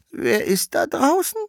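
very high, put-on granny voice; old grandmother calling. Grandmother calls to whoever knocks, very high old-woman voice. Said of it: "und rief deshalb".

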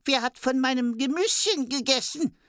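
very high tiny dwarf voice; squeaky dwarf. A narrator's reading. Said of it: "Der vierte".

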